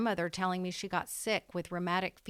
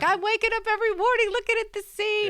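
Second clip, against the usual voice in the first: much higher, faster Irish brogue full of wonder; grandmother's Irish accent; 13 semitones higher, 7 dB louder, faster than usual. Retold or imitated. Granddaughter voicing grandma's joy at the sea in an Irish brogue. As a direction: gush like the delighted old Irish grandma. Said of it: "that's a great accent".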